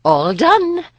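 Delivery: put-on high, loud old woman's call. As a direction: announce the end like an old crone. Old woman ends trance.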